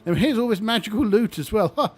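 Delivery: high, jolly lich host voice; chirpy undead host, eager to please; friendly lich. Acted. Lich greeting the young adventurers, high and chummy.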